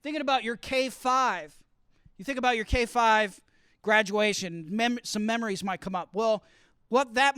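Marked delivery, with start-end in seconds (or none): none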